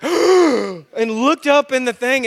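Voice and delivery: normal talking voice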